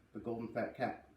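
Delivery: ordinary delivery, nothing marked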